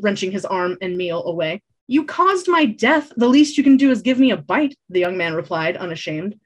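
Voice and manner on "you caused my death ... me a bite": mock wounded bard. Bard demanding bite, dramatic.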